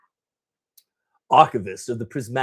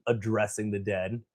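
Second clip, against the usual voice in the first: usual speaking voice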